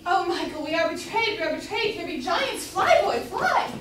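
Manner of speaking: high, loud alarm building to a shriek; panicked cry. Acted. Mother warns her son to flee, panicked shriek. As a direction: cry out that all is lost.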